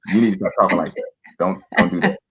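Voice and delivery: quick, low telling-off; curt demand. Shown as a demonstration. Husband scolding his wife the wrong way, curt demand. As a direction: order it briskly, no softening. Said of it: "Don't be coming to your wife saying".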